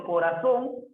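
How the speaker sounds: "corazón" drawn out slowly, weight on "zón"; stress on last syllable. Shown as a demonstration. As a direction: stretch "corazón" and lean on its final "zón". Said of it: "la mayor fuerza de voz en la sílaba son".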